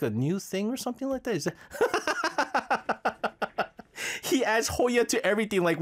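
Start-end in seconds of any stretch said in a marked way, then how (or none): 1.7-4.2 laughing. Real, not acted. Host laughs at the mix-up.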